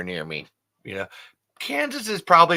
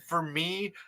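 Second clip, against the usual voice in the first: ordinary delivery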